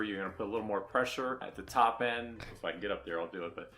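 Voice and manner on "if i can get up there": ordinary talk, nothing marked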